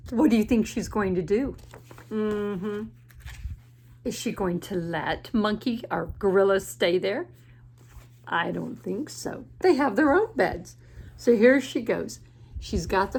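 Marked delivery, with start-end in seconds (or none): none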